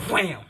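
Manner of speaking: shouted wham as a slap; loud slap sound effect. Acted. Narrator shouting the sound of mom's slap, loud. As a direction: belt out the slap noise. Mom slaps Bakugo across cheek.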